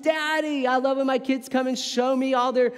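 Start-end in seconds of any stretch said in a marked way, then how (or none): 0.0-0.7 put-on high, excited little-kid call of Daddy; child's scream. Kids running to dad, yelling Daddy in excitement. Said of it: "they all screamed".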